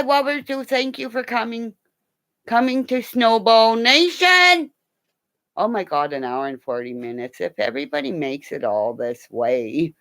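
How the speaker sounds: ordinary talk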